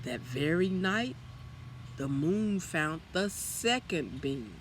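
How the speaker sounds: usual speaking voice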